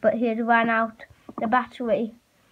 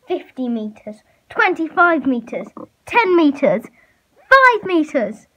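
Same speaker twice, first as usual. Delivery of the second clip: building suspense; 3 semitones higher, 6 dB louder than usual. Acted. Countdown as T-Rex closes in.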